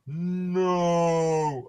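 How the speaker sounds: drawn-out slow-mo no; slow motion. Pretend. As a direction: stretch it out like a replay. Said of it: "Let me do that in slow-mo".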